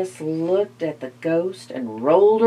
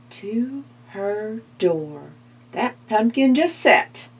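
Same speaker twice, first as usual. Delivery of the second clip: usual speaking voice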